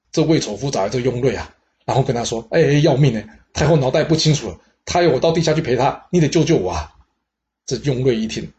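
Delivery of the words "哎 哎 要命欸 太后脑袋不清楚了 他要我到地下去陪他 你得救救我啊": higher and louder, hammed-up desperate begging; frightened lover begs his advisor for help, in mock panic; panicked pleading. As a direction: plead for your life, half comic. Lover begs advisor to save him.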